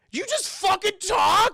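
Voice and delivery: shocked yell. Retold or imitated.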